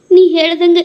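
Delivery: ordinary talk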